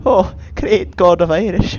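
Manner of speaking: loud, hammed-up Irish invocation; Irish accent. Creature hailing the lightning god, Irish accent. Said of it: "He's Irish. I don't know why is he Irish".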